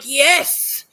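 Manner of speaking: a loud, delighted cheer; excited yes. Retold or imitated. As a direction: cheer at your own clever idea. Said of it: "And I'm like".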